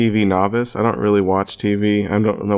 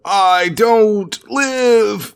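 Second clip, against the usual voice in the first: dying hero; 12 semitones higher, 3 dB louder, much slower than usual. Retold or imitated. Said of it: "He was like".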